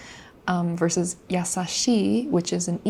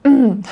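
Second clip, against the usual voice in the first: loud mmm of delight; 4 semitones higher, 11 dB louder than usual. Retold or imitated.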